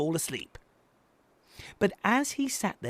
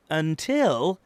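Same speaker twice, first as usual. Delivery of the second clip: plain reading voice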